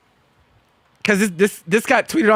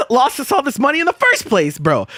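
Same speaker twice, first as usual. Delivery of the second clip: ordinary delivery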